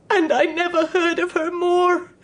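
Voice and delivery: crying through the words; tearful. Acted. Mother crying as she tells the visitor her daughter left. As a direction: let the grief break through.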